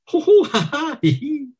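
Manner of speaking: high shrieking laughter; chorus of laughter. A narrator's reading. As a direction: laugh all together. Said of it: "girls laughing".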